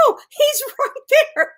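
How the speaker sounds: very high, loud laughing protest; high squeal breaking into laughter; laughing insistence. Retold or imitated. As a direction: insist through helpless giggles. Insisting to dentist, high on gas.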